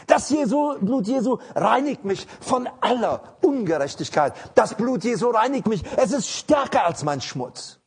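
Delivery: full-force pulpit delivery; fiery preaching. Acted. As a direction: preach it out to the congregation.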